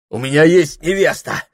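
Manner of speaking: high, loud outburst; startled cry. A narrator's reading. Прохор starting up, startled cry. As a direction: cry it out with a jolt. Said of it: "вскрикнул, встрепенулся Прохор".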